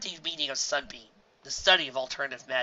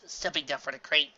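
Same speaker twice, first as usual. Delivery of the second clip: ordinary delivery, nothing marked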